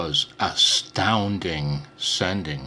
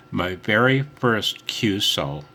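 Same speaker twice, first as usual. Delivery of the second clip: usual speaking voice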